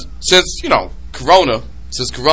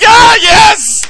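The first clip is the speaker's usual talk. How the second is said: high, loud cheer of delight; excited cry; 4 dB louder than usual. Retold or imitated. A fan finally hears the catchphrase, cheering. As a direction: yelp with joy like a fan. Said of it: "I'm like".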